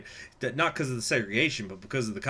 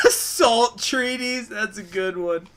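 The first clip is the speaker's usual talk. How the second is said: high squeaky laughter through the words; can barely get the words out for laughing; laughing hard; 11 semitones higher, 7 dB louder, much slower than usual. Real, not acted. Streamer loses it laughing at a silly event, high laugh.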